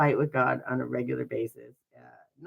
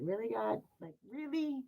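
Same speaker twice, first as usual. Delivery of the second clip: high, sarcastic disbelief at God; 8 semitones higher than usual. Retold or imitated. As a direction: roll your eyes at the sky. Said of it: "I have that sarcastic".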